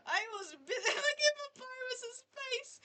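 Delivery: high laugh through words; talking while laughing hard. Real, not acted. Laughing protest.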